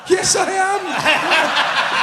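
very high, slow, laughing shout; gleeful shout. Retold or imitated. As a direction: yell it at the TV in triumph. Joking reply to TV host's jibe.

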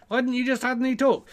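high puppet voice; challenging puppet. Pretend. Puppet asks why it wasn't allowed to talk, high challenge.

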